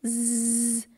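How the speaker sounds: long held buzzing "z"; isolated z sound. Shown as a demonstration. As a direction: draw out "z" as a bare sound. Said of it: "I pronounce the S".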